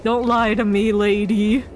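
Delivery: ordinary talk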